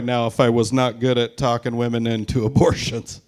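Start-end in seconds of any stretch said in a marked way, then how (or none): none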